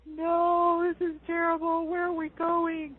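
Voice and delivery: high, slow, worried whine; panicked inner voice. Retold or imitated. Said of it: "you're going".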